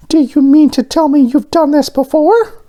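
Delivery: very high, loud insistent little voice; high demanding donut. A narrator's reading. Arnie confronts Mister Bing, high and loud. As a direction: press him for an answer.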